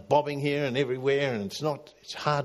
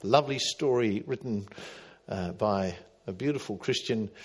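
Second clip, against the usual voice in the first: ordinary delivery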